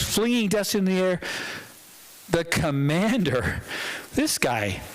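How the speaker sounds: ordinary talk, nothing marked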